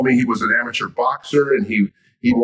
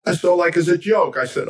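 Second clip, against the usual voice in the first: ordinary talk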